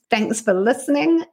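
normal talking voice

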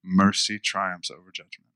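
ordinary talk, nothing marked

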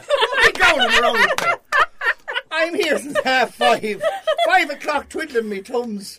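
high, loud, slow mock complaint through laughter; mock indignation. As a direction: play the put-upon man who waited for hours, laughing. Acting out his retort, laughing.

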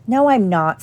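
ordinary delivery

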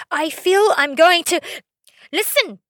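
barely holding back rage; about to explode. Retold or imitated. She's about to blow up.

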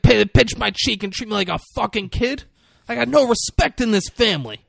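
high, loud aggrieved rant; imitating an insulted mobster; acted outrage. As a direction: vent the grievance loudly, pitched well up. Acts out mobster's outraged quarrel.

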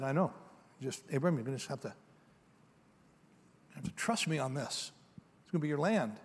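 soft, hushed reassurance from God; quiet and calm, trust me; gentle God voice. Acted. God gently reassuring Abram, soft and calm.

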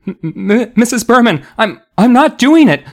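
high, loud, flustered stammer. A narrator's reading. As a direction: stammer out a panicked denial. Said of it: "Chris stammered".

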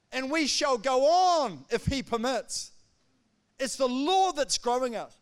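loud, ringing preacher's proclamation; rising sermon fervour; fervent preaching. Acted. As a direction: preach it out to the congregation. Preaching at full force.

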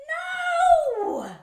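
loud, high wail of refusal; horrified protest. Acted. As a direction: cry out in comic horror. Protests at being called a monkey.

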